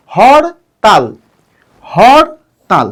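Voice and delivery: "Hartal" slowly in two beats, হর, pause, তাল; split into syllables. Shown as a demonstration. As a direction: break "hartal" cleanly after হর. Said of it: "দুই অক্ষর বিশিষ্ট". ("হরতাল" ধীরে দুই ভাগে, হর... তাল)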